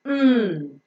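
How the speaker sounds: "mm" as a long nasal hum; phonic M sound. Shown as a demonstration. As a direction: hum "mm" on closed lips. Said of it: "It has got the phonic sound of".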